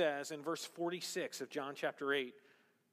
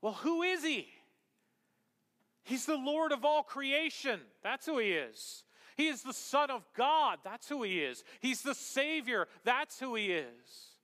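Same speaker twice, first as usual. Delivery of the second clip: high, loud proclamation, each title hammered; preaching at full force; 13 semitones higher, 7 dB louder than usual. Acted. Pastor answering the crowd's question, preaching at full force. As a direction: proclaim it to the rafters.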